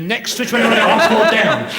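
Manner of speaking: busy doctor calling patients, laughing; brisk doctor. Retold or imitated. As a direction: rattle it off laughing through it. Doctor dispensing drugs, voiced.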